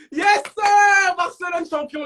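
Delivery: loud high celebratory yell; loud cheer for the score. Real, not acted. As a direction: cheer the win.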